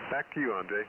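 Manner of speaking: ordinary talk, nothing marked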